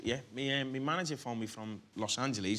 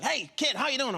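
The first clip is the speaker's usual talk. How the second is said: quoting Scorsese; 14 semitones higher, 7 dB louder than usual. Retold or imitated. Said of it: "and he's like".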